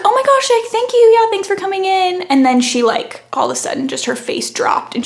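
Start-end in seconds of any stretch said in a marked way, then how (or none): 0.0-2.2 high, louder nervous politeness; mock-nervous overpolite gush; flustered gushing thanks. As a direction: gush thanks to smooth it over. Barista's flustered polite reply.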